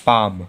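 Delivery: पाम said "paam" with a low, silent L; model pronunciation. Shown as a demonstration. Teacher answers her own question with पाम. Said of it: "तो इसे क्या पढ़ेंगे?".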